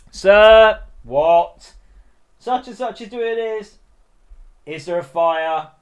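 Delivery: high, loud tattling schoolkid; mock whiny pupil. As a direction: call out to sir like a telltale pupil. Pupil voiced to supply teacher.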